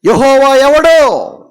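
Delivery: high, loud, slow sneer; arrogant Pharaoh. Acted. Pharaoh scorns Moses's God, loud and high. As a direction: bellow it out with mocking pride. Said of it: "అంటున్నాడు".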